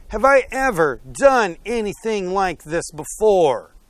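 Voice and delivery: high-pitched reasoning donkey voice; pleading donkey. Acted. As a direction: plead your case as a wronged donkey. Donkey pleads its case to owner.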